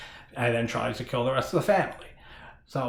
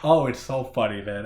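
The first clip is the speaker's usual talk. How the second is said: normal talking voice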